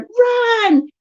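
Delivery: high, drawn-out shout; high-pitched go signal; shouted command. Retold or imitated. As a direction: send the kids off running. Retold shout to start running.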